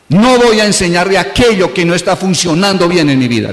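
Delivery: normal talking voice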